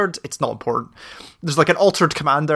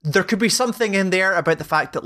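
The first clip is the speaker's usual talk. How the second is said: ordinary delivery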